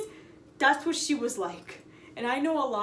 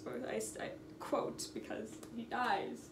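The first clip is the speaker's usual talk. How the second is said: whispered aside; 3 semitones lower, 13 dB quieter than usual. Real, not acted. Unvoiced aside.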